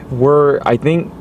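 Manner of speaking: usual speaking voice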